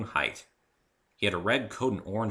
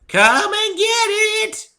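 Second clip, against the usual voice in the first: put-on high, slow, loud old granny holler; old mare calling the family to eat; 14 dB louder, much slower than usual.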